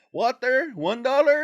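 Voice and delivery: high, loud hawking of water; street vendor call. Retold or imitated. Vendor calling to the line of cars, high sing-song hawk. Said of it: "people walking up and down like".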